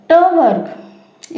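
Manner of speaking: teacher drawing out ट वर्ग for students; slow stressed demo. Shown as a demonstration. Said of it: "next हमारे पास आता है ट".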